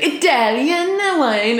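high, slow, hammed-up Italian accent; playful mock Italian accent, stretched out. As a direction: ham up an Italian accent on the wine. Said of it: "say something in an offensive Italian accent".

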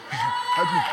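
sudden very high shouted cry; exclaiming shout. Real, not acted. Preacher crying out to God mid-sermon, shout. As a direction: burst out in a cry for help.

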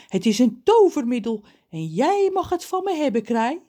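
high, loud salesman-like pitch; story character John. A narrator's reading. John gives the crow his magic remedy, high and emphatic. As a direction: offer it grandly in a high voice.

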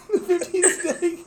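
squeaky, laughing high repeat. Real, not acted. Cracking up while telling the story, high laughing voice. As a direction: choke out the words through laughter.

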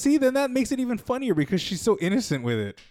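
high-pitched, quick, eager insistence; excited arguing. Real, not acted. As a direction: jump in eagerly to make your case. Excitedly argues the joke is funny.

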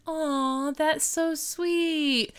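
high, drawn-out "aw, sweet"; mock cooing. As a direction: coo it slowly in imitation. Retold girl touched by remark.

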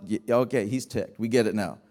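ordinary delivery

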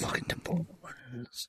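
slow whispered "Look at the bones."; creepy whisper. Pretend. Player echoing the narrator in a slow creepy whisper. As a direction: breathe it like a ghoul.

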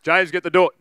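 mock dad raising his voice. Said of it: "attitude as a kid".

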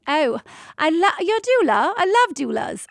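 high, gushing London English; British accent. Acted. London anesthesiologist delighted to meet a doula, British accent. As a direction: greet them delightedly in a put-on British accent. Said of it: "that wasn't a British accent".

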